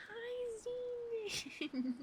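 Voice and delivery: light up at the name; thrilled high greeting; excited gasp hello. Real, not acted.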